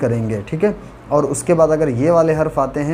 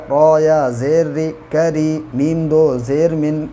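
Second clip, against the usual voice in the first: usual speaking voice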